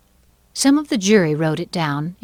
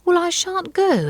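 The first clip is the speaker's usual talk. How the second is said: put-on high, defiant girl; refuse, pitched high; defiant Alice; 13 semitones higher, 4 dB louder than usual. A narrator's reading. Alice defies the king.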